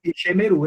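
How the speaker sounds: "Ishemeru" with the e after sh voiced; four syllables in "Ishemeru", i-she-me-ru; sounded Sheva. Shown as a demonstration. Teacher confirming the hypothetical reading, "Ishemeru" with an e after sh. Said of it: "se fosse sonoro você leria Ishemeru".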